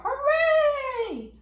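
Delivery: high, loud cheer; cheering hooray. Acted. Space monsters cheer at floating bloomers, loud. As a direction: shout it like a happy monster crowd.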